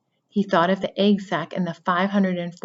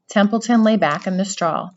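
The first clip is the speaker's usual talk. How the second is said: plain reading voice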